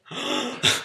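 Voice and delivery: sharp voiceless intake of breath; shocked gasp. Retold or imitated. Acting out friends dropping in dream, shocked gasp. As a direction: gasp as if witnessing it, acted.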